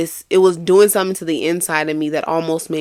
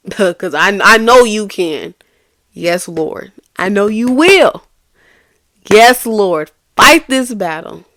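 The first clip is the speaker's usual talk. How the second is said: loud, high, rising declaration of faith; fervent prayer; 3 semitones higher, 7 dB louder, slower than usual. Retold or imitated. Teacher prays for God to fight her battle, loudly fervent. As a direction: proclaim trust in the Lord. Prayer continues, building intensity.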